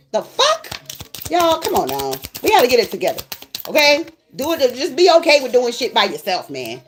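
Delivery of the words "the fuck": squeaky high disbelief; high incredulous the fuck. Real, not acted. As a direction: jump way up in disbelief. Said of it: "Oh, ain't no more business".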